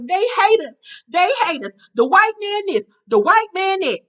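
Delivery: high, loud mocking whine; hammed-up distressed complaint; Kaylin. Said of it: "And Kaylin like".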